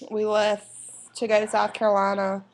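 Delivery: usual speaking voice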